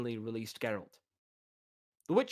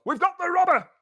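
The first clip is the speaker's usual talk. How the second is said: much higher, loud shout; triumphant yell; 8 dB louder than usual. Acted. Masons shouting to their master.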